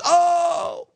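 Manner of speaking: high, loud mock gasp of delight; squealed oh of a thrilled clerk; gushing oh. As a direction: play the clerk overwhelmed with joy. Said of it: "She goes".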